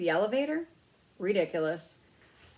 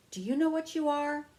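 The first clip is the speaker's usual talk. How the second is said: sleepy; 8 semitones higher, 3 dB louder than usual. A narrator's reading. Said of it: "the man sleepily asked".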